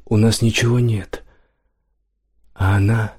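halting recollection with long pauses; detached. A narrator's reading. Dazed merchant recalls her to no one, detached and halting. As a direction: drift through the memory, phrase by phrase. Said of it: "отрешённо проговорил он, ни к кому не обращаясь".

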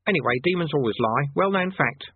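conjurer dropping the grand tone; chatty, matter-of-fact aside; normal voice. Acted. Conjurer dropping his act to explain to Rincewind, matter-of-fact. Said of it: "In a more normal voice".

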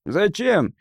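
lightly voiced drunk man; drunk heckler. A narrator's reading. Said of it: "отозвался довольно пьяный голос".

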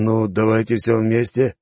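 slow, unsure drawn-out answer; hesitant drawl. Acted. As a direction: stretch всё out hesitantly. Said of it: "нерешительно протянул".

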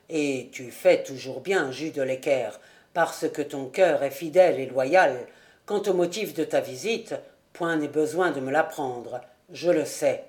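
put-on low, steady voice of a mysterious old charcoal burner; charcoal burner Rouan. A narrator's reading. Charcoal burner welcoming Jude into his hut, low and knowing.

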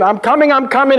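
high, excited call of a rescuer; mock scream. Hero swinging on a rope to save a man, high excited call. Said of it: "he would scream in".

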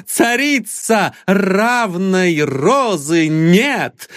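put-on a drunk calling out the laughers; drunken accusing rant. A narrator's reading. Drunk hero accuses laughing crowd.

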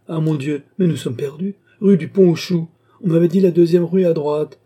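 suddenly panicked, we're lost; alarmed exclamation. Acted. Lady realizes they are lost, loud alarmed cry.

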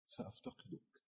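soft whispered farewell. A narrator's reading. Tearful Sienna parts from the professor, whispering. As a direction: whisper it close to the listener. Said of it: "همست".

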